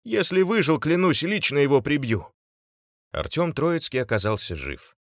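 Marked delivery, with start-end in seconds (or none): 0.0-2.3 high-pitched, aggrieved threat; strained, raised angry voice; angry vow. A narrator's reading. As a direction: swear revenge through the pain. Injured man vows revenge, still groaning.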